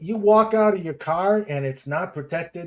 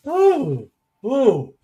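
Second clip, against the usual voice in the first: high ooh; 9 semitones higher, 6 dB louder than usual. Real, not acted. Bracing before reading.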